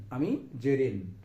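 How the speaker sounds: ordinary talk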